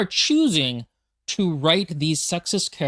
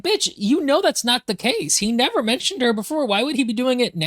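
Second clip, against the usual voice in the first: mock exasperation; 7 semitones higher than usual. Reader scolds a clueless character.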